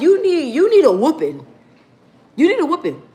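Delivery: retold scolding with exaggerated pitch; lightly hammed-up threat of a whooping; mock scolding. Acted scolding of a rude kid.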